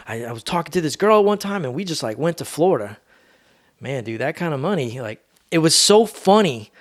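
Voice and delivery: ordinary talk, nothing marked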